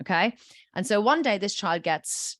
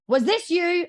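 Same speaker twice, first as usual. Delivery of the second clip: high-pitched pointed question; accusing parent; 9 semitones higher, 4 dB louder than usual. Acted. Parent turns to the child, accusing tone. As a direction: put the child on the spot. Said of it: "parent turns to the child and says".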